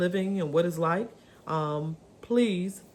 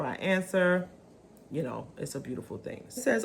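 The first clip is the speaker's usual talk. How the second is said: ordinary talk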